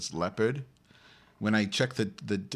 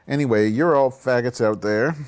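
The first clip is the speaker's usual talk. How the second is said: loud taunt; 3 semitones higher, 9 dB louder than usual. Real, not acted. Loud mock insult to chat.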